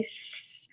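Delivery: long unvoiced hiss; rats hissing. A narrator's reading. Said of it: "hissed the other rats".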